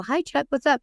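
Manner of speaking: high-pitched, chirpy phone hello; put-on casual greeting. Caught out by the probation officer's call, high put-on casual greeting. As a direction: greet him in a high, innocent lilt.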